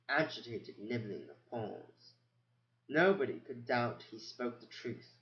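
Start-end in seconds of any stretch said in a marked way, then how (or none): none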